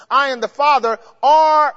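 "I and the Father are" called out loud, each word pressed; emphatic quoting. Shown as a demonstration. Preacher booms the King James order at the church, loud. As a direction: boom the verse to the congregation. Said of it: "your Bible says".

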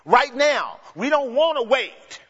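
desperate crowd question; urgent plea. Retold or imitated. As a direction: press for an answer right now. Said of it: "They're saying".

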